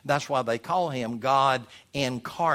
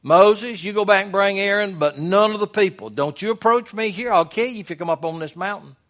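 raised, commanding warning; the law speaking; 5 semitones higher, 6 dB louder than usual. Acted. The law warns people off Mount Sinai, stern command. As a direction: forbid them sternly, louder at the start. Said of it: "That's the law speaking".

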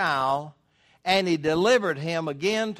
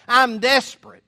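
louder cry of a desperate father; desperate plea; 6 semitones higher, 9 dB louder than usual. Acted. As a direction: plead as the father at the end of his rope.

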